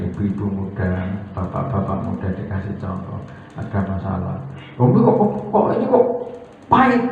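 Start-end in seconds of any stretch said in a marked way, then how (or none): 4.8-7.0 complaining husband. Retold or imitated. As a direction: squawk at the bitter tea. Husband complains tea bitter.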